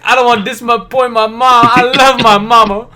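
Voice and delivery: mock mama's boy. Teasing Dakota by voicing his mama devotion, whiny. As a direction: ham up a sentimental friend. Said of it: "And Dakota would be like".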